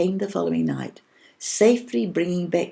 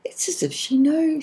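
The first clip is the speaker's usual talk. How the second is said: wonder; 11 semitones higher than usual. A narrator's reading. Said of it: "Willow looked at her in wonder".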